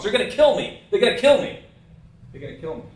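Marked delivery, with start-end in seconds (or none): 0.0-1.6 high, loud panicked phone cry; mock scream, raised in pitch and volume; imitated screaming. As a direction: yell it out, imitating a desperate caller. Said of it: "screaming at the top of his lungs".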